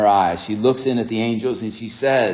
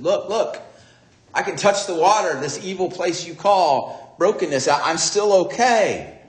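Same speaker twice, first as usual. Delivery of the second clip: raised, insistent appeal; urging; 6 semitones higher, 3 dB louder than usual. Retold or imitated. Missionary shows tribe the water is safe, raised urging voice. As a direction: lift the voice to persuade.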